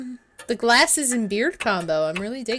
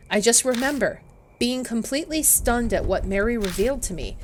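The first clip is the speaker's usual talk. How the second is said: ordinary delivery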